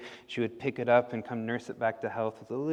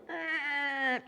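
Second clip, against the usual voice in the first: high creaky squeak of a gate. Shown as a demonstration. Teller imitating a squeaky gate for the kids. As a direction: squeak it like a rusty hinge. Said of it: "it went".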